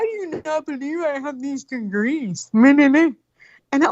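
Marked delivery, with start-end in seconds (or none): 0.0-2.5 mocking whiny guy. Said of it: "He was like". 2.5-3.1 mocking meh-meh. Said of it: "mocking blather".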